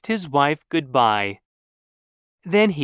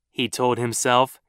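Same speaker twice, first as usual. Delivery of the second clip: usual speaking voice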